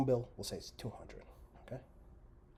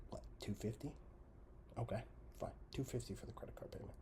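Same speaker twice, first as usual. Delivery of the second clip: softer, half-whispered sums; muttered math; 7 dB quieter than usual. Real, not acted. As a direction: mutter the numbers to yourself. Muttering while calculating.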